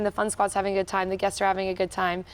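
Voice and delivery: ordinary delivery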